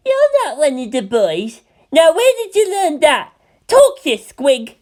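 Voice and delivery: put-on high, loud, demanding child voice; indignant little girl. A narrator's reading. Nozomi confronts Toji, high indignant demand. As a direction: interrogate him like a fierce little boss. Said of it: "her pigtail quivering in indignation".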